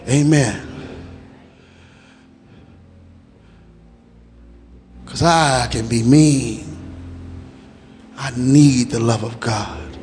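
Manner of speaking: low, intimate prayerful plea; hushed altar call. Acted. Pastor inviting people forward to pray, in a low hushed plea. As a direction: drop down and draw them in gently.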